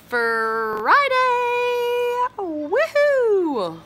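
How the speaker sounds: very slow drawn-out, then high woohoo; stretching the words to savor Friday; cheering. Real, not acted. Celebrating Friday.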